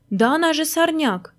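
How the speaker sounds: выше, с возмущением; возмутись громко; возмущённо. A narrator's reading. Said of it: "возмутился правый Маськин тапок". (тапок возмущается пальмой)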